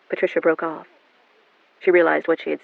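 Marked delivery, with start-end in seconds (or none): none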